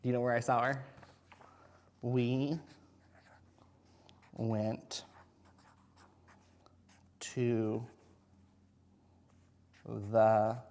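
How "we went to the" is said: word by word, long pauses; slow dictation. Shown as a demonstration. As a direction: spell it out slowly while writing. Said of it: "So I wrote".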